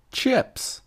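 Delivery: excited. Acted. Big reveal of his favourite topic.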